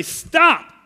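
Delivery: loud, high cry of stop; sharp shout. Retold or imitated. Jesus rebuking worship, a sudden loud stop. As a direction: cut them off sharply. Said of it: "he would be right to say".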